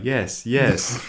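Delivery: robotic yes in a computerized voice. Shown as a demonstration. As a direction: say it like a flat computer voice. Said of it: "a computerized voice would be like".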